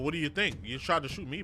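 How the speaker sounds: usual speaking voice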